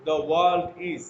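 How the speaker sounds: the world is, read slowly with 'the' said as dee; teacher models "the world" with 'the' as dee. Shown as a demonstration. Teacher reading a model sentence to students, clear and slow. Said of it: "আমি যখন পড়েছি ... উচ্চারণের মধ্যে তারতম্য ছিল".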